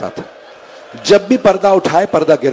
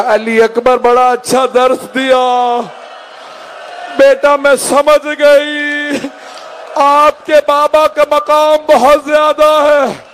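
high, slow wailing lament; weeping mother; 8 semitones higher, slower than usual. Retold or imitated. Weeping mother answering her son, grief-stricken wail. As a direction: wail it out on long, held high notes. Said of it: "जनाब लैला जारो कतार रोने लगी".

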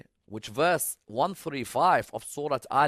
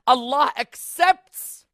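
high, loud, drawn-out preaching; stretched-out sermon cry; impassioned proclamation; 11 semitones higher, 12 dB louder, much slower than usual. Real, not acted. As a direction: proclaim it slowly at full voice. Proclaims the verse's meaning to congregation.

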